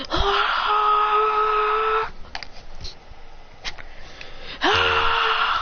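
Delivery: long, very high pained scream, volume held back; mock scream of pain. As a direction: scream as if it really hurts, without full force. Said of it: "Screaming 'cause it actually hurts".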